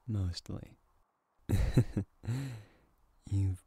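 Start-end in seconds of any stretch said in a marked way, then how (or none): 1.5-2.6 loud, playful giggle; teasing laugh. Acted. Laughing at the partner who is mostly back to normal.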